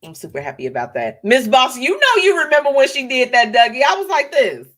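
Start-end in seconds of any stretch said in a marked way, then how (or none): 1.2-4.7 louder, higher call to a viewer; loud excited shout-out. Real, not acted. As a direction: hype up the viewer.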